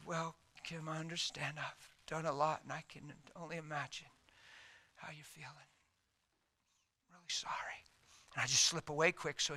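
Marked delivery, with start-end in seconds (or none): 0.0-7.8 soft apology. Retold or imitated. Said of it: "And I said".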